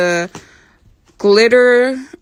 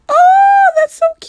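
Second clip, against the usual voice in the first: excited squeal; 7 dB louder than usual. Real, not acted. Squeal over a cute product.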